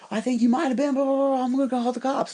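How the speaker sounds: mock accusing. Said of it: "he's like".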